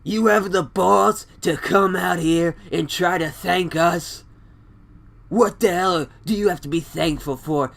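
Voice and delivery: bad impression of an outraged promo; Triple H. Husband berates Ronda over his wife's arm, bad angry impression. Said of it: "it's my Triple H impression".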